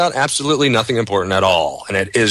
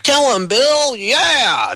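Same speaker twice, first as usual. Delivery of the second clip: much higher, cheering mock crowd voice; much slower than usual. Mocking the crowd cheering on Bill Clinton, excited. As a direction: whoop like a mindless crowd. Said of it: "all the people were like".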